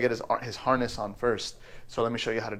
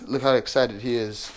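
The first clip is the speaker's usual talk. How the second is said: usual speaking voice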